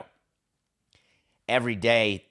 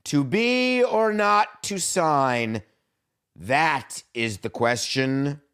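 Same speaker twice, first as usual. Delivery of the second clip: high, slow theatrical declamation; a pompous Hamlet send-up; mock Shakespearean; 5 semitones higher, 4 dB louder, much slower than usual. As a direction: recite it like a hammy stage actor. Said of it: "I'm gonna be very serious now".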